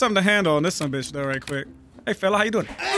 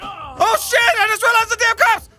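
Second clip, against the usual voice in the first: panicked yell; 11 semitones higher, 3 dB louder, slower than usual. Acted. Spots the police, panics.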